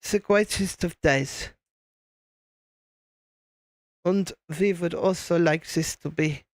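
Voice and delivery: put-on slow, deliberate German accent; measured German-accented English; Werner Herzog. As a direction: speak calm, drawn-out English with a German accent. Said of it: "the English language in a German accent".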